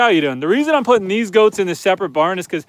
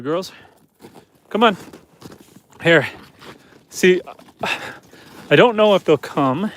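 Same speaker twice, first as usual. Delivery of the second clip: normal talking voice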